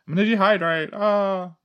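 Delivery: high hammed-up fretting; mock panic. Joking about dehydrating without water, mock panic. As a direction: pretend alarm in a high voice.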